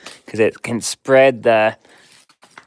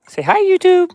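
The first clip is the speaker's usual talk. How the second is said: much higher sing-song voice to the dog; pet talk; 6 dB louder than usual. Real, not acted. Owner coaxing his dog to greet viewers, high pet voice. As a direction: coo it up high like talking to a puppy.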